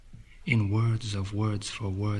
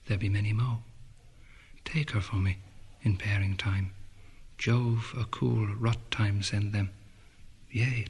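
ordinary delivery, nothing marked

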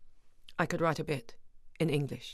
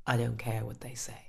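defiant whisper; 5 semitones lower, 4 dB quieter than usual. Acted. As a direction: breathe it out, unvoiced. Defiant aside.

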